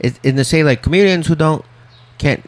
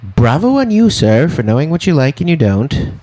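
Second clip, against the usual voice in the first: sarcastic; 6 semitones higher, 4 dB louder than usual. Retold or imitated. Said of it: "I was kind of like".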